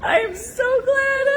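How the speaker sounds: high, slow, crying with laughter; laughing hard. Real, not acted. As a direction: laugh until it almost sobs. Laughing hard after the run.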